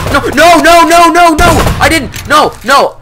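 high screaming no, no, no; anguished denial. Real, not acted. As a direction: shriek it out in despair. Game loss, screaming denial.

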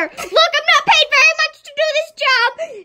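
high, pleading exasperation; worn-out camera operator pleading; exasperated crew. Acted. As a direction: beg the kid to just sit down.

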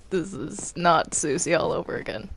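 ordinary talk, nothing marked

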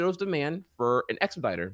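ordinary delivery

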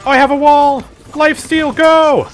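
high frantic yelling; panicked shouts mid-fight; yelling while running away. Real, not acted.

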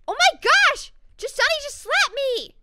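very high, loud shriek of disbelief; shocked outburst. Real, not acted. Gamer just slapped by her character, shocked high yell. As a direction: cry out at the top of your range, appalled.

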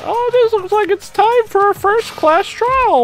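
very high, loud cartoon mascot voice; gleeful villain host announcing a trial; hammed-up squeaky mascot calling a trial. Voices game host bear.

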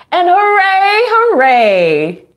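squealing with delight; excited cheer. Acted. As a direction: cheer it like a thrilled crowd. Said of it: "They're excited".

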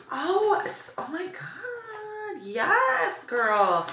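high, drawn-out gasp of delight; thrilled squeal. Real, not acted. Vlogger finding a mask she wanted, thrilled. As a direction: squeal slowly, overcome with delight.

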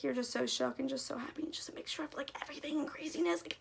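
whispering fangirl excitement; breathy whisper. Real, not acted. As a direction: hush it as if overwhelmed. Gushing about mixed emotions.

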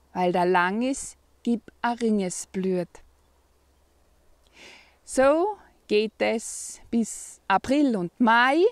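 lively, measured reading of a dialect poem; poem recitation. Acted. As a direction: recite a spring poem with swinging emphasis and pauses between verses. Reads a dialect spring poem aloud. (Mundartgedicht betont vorgetragen)

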